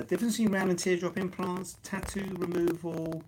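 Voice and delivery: thin, distant live-stream mic; crackly first mic. Real, not acted. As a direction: through a weak crackly mic. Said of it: "take two".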